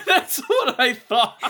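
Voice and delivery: words squeezed out between laughs; laughing. Real, not acted. Laughing at friend's bad barking.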